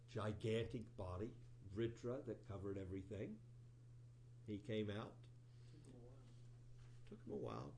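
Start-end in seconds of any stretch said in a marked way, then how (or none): 5.7-6.2 barely audible under the breath; muttered aside. Real, not acted. Teacher muttering a wry aside before repeating it. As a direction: mumble it to yourself.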